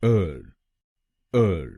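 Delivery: er said alone as a short er; teacher isolates er; syllable drill. Shown as a demonstration. Teacher drills students on the middle syllable, er. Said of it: "हम बोलेंगे".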